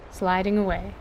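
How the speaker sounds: ordinary delivery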